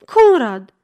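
loud, urgent call of a name; girl's cry. A narrator's reading. Girl cries out as detectives take him, loud. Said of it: "țipă fata".

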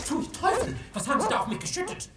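loud, high cry of disgust; disgusted outburst. Acted. Neighbour splashed with goat milk shouts in disgust, high and loud. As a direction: yell in revolted shock.

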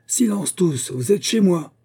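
high, loud yell for silence. A narrator's reading. Old man on a chair yells at his guests, loud. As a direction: bellow over the crowd. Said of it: "il grimpa sur une chaise et hurla".

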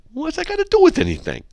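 loud squeaky high protest; mock bafflement. Host answering his own absurd example, mock bafflement. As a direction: shoot way up high, hammed-up bewilderment. Acts baffled reply to the parody.

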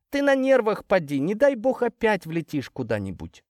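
high mother voice, easing down toward the end; worried mother. Acted. Mother warning her upset son about driving, worried. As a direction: fret at him in a high-pitched voice.

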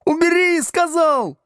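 very high-pitched pained yell; Градусов. A narrator's reading. Pupil held by the ear orders teacher off, yelling. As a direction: yelp the order in protest. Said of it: "заорал Градусов".

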